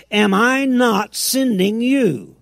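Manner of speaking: drawn-out, emphatic divine challenge; commanding Lord. Acted. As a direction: voice God's insistent rhetorical question. Acting the Lord commissioning Gideon.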